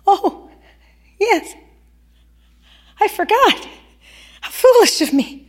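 high, lightly voiced woman catching herself; a hint of a flustered porch lady; self-mocking woman. Embroidering woman chiding herself over forgotten peas, high and light.

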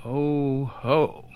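soft, listless reaction; apathetic. A narrator's reading. Visitor seeing the envelope come out, apathetically. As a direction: say it softly, with no interest. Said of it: "I said apathetically".

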